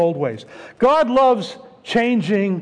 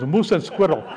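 imitating a Russian accent, pitched down; low mock-Russian accent; thick Russian accent; 4 semitones lower than usual. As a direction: put on a thick Russian accent for comic effect. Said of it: "thick Russian accent".

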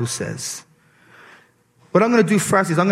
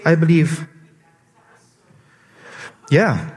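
normal talking voice

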